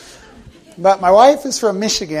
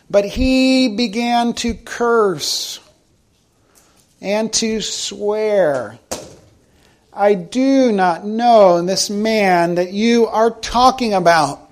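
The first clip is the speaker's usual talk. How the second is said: slow scripture reading; 3 semitones higher, much slower than usual. Acted.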